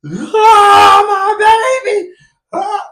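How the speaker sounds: put-on high crying scream of my baby; imitating mother's anguished scream; wailing mother. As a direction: wail like a mother losing her son.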